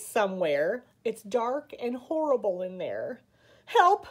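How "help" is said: higher, louder cry for help; scared. A narrator's reading. Eddie lost in the dark woods, scared. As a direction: call out in a scared little fright. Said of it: "said Eddie. "I'm scared already".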